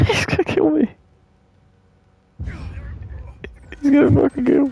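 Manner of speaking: very high, squeaky panic; panicked squeal. Real, not acted. Player fears being killed in game, panicked squeal.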